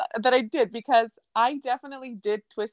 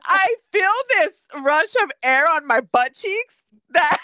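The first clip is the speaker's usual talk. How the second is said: usual speaking voice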